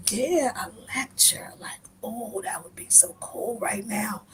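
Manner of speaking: breathy whisper, voice dropped right down; whispered aside. Retold or imitated. Aside about the lecturing driver, sneaky whisper. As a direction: murmur it so he cannot hear.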